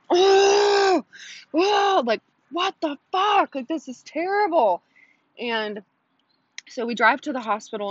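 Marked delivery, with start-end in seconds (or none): 0.1-4.8 high, drawn-out moaning outcry; retold labor wail. Woman in labor in the driveway, high agonized wail. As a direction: imitate wailing through a contraction.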